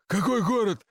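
high-pitched narrated shout; hoarse shout. A narrator's reading. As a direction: call out the question, lightly acted as hoarse. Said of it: "хрипло закричал главреж".

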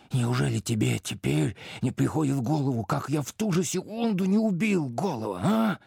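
rising to a high, strained pitch; agitated questioning. A narrator's reading. Prince challenges his servant about killing Голый, agitated. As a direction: press the question harder, climbing high to the final challenge.

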